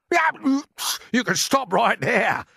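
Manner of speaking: high, loud objection; sharp. A narrator's reading. Earthworm objecting to being bait, sharply. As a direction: cut him off sharply and loudly. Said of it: "the earthworm said sharply".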